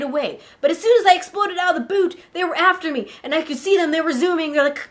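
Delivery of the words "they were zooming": plain reading voice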